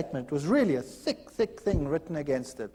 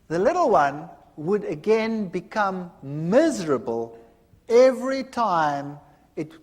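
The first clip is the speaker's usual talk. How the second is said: ordinary delivery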